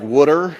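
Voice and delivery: "would'er" with a flapped d and r ending, for water; Delaware would'er. Shown as a demonstration. Preacher shows local speech, "would'er" for water. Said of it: "we say words like".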